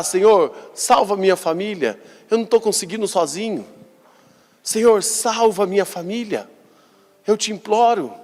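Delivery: pleading cry. Retold or imitated. A father who failed cries to the Lord, high and pleading. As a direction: beg the Lord to save the family. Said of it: "ele precisa gritar".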